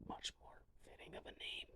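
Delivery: a hushed, breathy joking aside; whispered aside. Real, not acted. Joking to viewers about the name, whispered.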